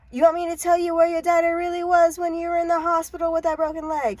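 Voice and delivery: high, insistent taunting call; taunting seer. Acted. Eerie stranger taunts the narrator.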